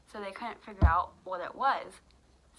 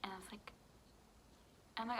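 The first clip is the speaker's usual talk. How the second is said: normal talking voice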